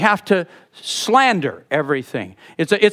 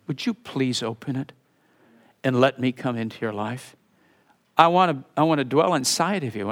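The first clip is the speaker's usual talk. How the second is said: soft, gentle plea, much softer than the sermon; sweet, tender; 4 semitones lower, 5 dB quieter than usual. Acted. Jesus pleading with a worldly church, soft and tender. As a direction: plead tenderly like a shepherd at the door. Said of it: "Do you hear the sweetness".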